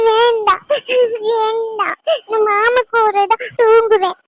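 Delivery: high, crying refusal; drawn-out sobbing whine; tearful stubborn child. Acted. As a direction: wail in protest like a sulking child. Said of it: "இப்படி அடம் பிடிச்சுக்கிட்டு இருக்கா".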